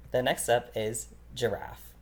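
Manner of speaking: ordinary talk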